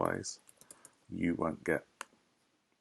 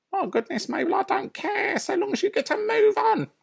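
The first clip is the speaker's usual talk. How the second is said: high, shrill-free bossy lady voice, much higher; Mrs Bean; 5 dB louder than usual. Acted. Farmer's wife calling down from upstairs, bossy and high.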